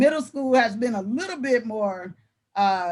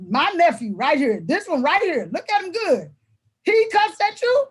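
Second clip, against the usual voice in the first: acted incredulous uncle; 7 semitones higher, 4 dB louder than usual. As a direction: act out an uncle's rising disbelief. Uncle confronts nephew in retold scene.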